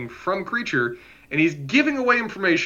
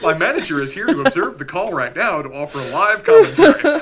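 laughing; 2 semitones higher, 10 dB louder than usual. Pretend. Continues the mock support call bit.

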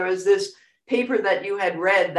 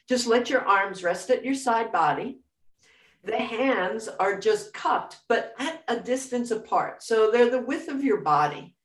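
guided meditation; 3 dB quieter than usual. Acted.

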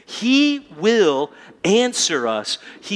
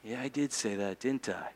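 much softer, lower, caught out; dad giving in, softer and lower; sheepish concession; 7 semitones lower, 14 dB quieter than usual. Retold or imitated. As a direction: admit it quietly like a busted dad. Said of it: "you feel it every single time".

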